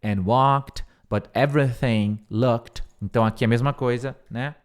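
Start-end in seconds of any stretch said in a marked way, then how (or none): 0.0-2.8 very slow model sentence for students; slowly, word by word, so they can repeat; slow repeat-after-me. Shown as a demonstration. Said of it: "Você pode até repetir comigo".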